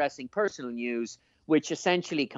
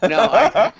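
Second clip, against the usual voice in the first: loud, higher-pitched burst of laughing; embarrassed laughter; 10 semitones higher, 10 dB louder than usual. Real, not acted. Co-host teased about trying an accent, laughing protest. Asked to try a hard accent.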